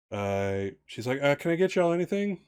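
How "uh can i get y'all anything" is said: lightly voiced Southern waitress, friendly; Southern waitress accent. Waitress Charlene offering more to the table in a light Southern accent. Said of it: "I always wanna do waitresses in a Southern accent".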